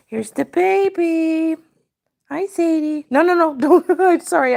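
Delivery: high, loud cooing to the cat. Real, not acted. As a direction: coo at the pet. Baby talk to the cat.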